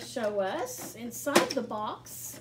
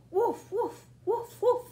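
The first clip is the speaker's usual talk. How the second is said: giggly puppy woofs; laughing mock dog barks; playful barking; 7 semitones higher, 4 dB louder than usual. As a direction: bark with a laugh in it.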